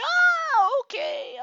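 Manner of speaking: squeaky high startled oh okay; surprised. Retold or imitated. Hearing the price, a shocked high oh. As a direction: jump way up in pitch at the news. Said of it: "I'm like".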